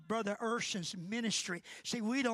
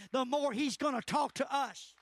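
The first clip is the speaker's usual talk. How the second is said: normal talking voice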